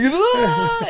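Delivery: sudden high yelp of pain; pained cry. Retold or imitated. Retelling how the speared buddy cried out, a high yelp. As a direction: cry out like the wind got knocked out.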